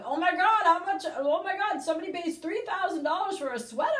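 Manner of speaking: high, loud, hammed-up panic over a price; mock freaking out. Shopper reacting to a price tag in a store, mock freaking out. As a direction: act out a rushing, high-pitched freak-out. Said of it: "not freaking out and going".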